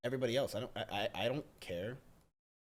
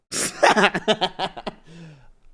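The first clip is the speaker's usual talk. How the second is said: big, high cackling laugh; loud burst of laughter; 5 semitones higher, 12 dB louder than usual. Real, not acted. Streamer laughing at the idea of being besties, loud laugh. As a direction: burst out laughing, then trail off.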